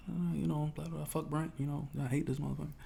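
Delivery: idly venting to mom on the phone, softly; casual phone chatter. Retold or imitated. Replaying phone chat with mom.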